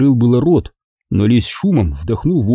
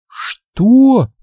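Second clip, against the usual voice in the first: high sharp what; startled; 13 semitones higher, 4 dB louder than usual. A narrator's reading. Margo startled by Engel, sharp and high. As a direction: caught off guard.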